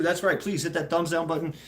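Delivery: ordinary talk, nothing marked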